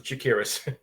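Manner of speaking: Chakiris said sha-KEER-is; second-syllable stress. Shown as a demonstration. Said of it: "I always pronounce it".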